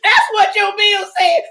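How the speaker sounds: high, loud words through laughter; laughing remark. Real, not acted. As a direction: say it while still laughing.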